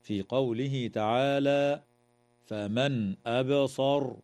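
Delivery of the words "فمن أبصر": the ن of فمن said clearly and the ب of أبصر bounced, slow recitation; clear nun with qalqala. Shown as a demonstration. Teacher isolating the phrase to show the rules. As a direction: pronounce the ن distinctly, then pop the ب of أبصر. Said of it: "وتقلقل الباء في كلمة أبصر".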